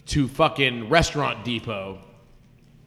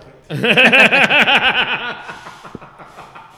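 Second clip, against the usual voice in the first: loud burst of laughter; big laugh; 9 semitones higher, 10 dB louder than usual. Real, not acted. Laughs at own boast.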